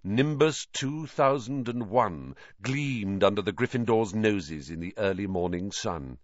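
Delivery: plain reading voice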